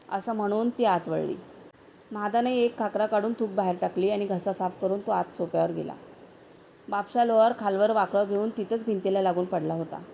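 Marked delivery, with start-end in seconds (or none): none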